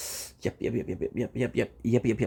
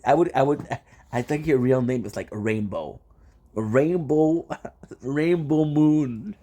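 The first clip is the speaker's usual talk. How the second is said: usual speaking voice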